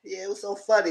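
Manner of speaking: ordinary talk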